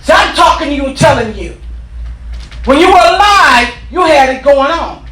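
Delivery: whooping preacher at full volume; preaching at full force. Acted. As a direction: preach it with rising fire.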